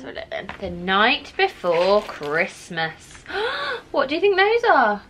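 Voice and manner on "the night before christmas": storybook reading. Acted. Reading book title to child.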